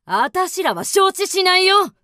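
高く大きな声で突っぱねる; きっぱり拒むように声を張れ; 強い反発. Acted. 妻たちが新しい女に反発. (新しい女房に反対する古女房、強く張った声)

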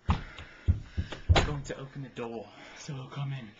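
whispering. Real, not acted. Plotting escape, hushed.

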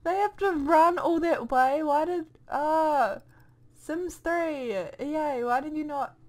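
high, loud exasperated complaint; outraged disbelief. Real, not acted. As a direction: rant at the game makers. Outraged at run distance.

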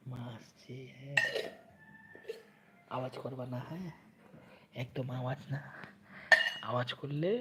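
soft, hushed warning while hiding. Pretend. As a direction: keep it low so mother won't hear. Hiding game, hushing baby.

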